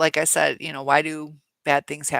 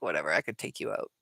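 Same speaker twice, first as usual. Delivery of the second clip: low, fast, mocking shrug of a voice; dismissive brush-off; 10 semitones lower, 3 dB quieter, faster than usual. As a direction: toss it off like a cocky narc who doesn't care. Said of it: "they're probably like".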